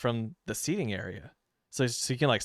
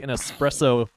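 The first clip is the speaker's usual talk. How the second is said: ordinary talk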